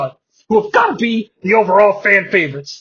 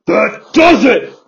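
angry shout; 4 semitones higher, 5 dB louder, slower than usual. Acted. Simmons fights back.

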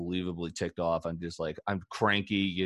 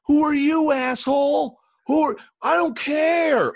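imitating a furious broadcaster, much higher and louder; angry outburst; 9 dB louder than usual. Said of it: "And this guy goes".

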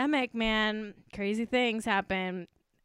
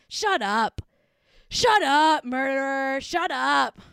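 mock outrage; slower than usual. Mock scolding of absent Patrizia.

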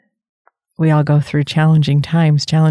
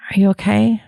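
concerned partner; 4 semitones higher, faster than usual. Retold or imitated. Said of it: "concerned partner reaches over and says".

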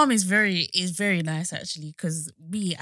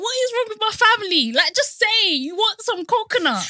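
higher, louder, fast exasperation; exasperated outburst; 13 semitones higher, 6 dB louder than usual. Retold or imitated. Mocking her family to her co-host, exasperated and loud. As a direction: throw up your hands at the family.

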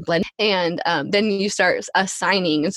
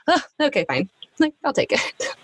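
put-on much higher, playfully grudging little girl; daughter accepting; 7 semitones higher than usual. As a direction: accept it like a pampered princess. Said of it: "she's like".

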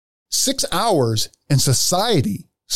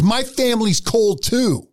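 high, drawn-out mock holler; slow plaintive yell, pitched up without volume; retold yell; 9 semitones higher, slower than usual. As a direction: imitate a man yelling in complaint. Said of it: "he's still yelling at me".

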